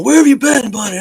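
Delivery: high, loud buddy greeting; hearty greeting. Acted. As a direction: greet him like a long-lost pal. Men greeting returning comrade.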